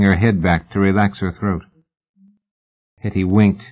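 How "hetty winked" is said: usual speaking voice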